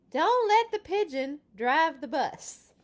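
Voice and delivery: bus driver's warning. Acted. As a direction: warn the kids firmly.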